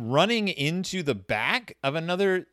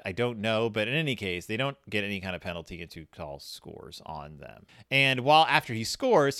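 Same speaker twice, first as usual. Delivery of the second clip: ordinary delivery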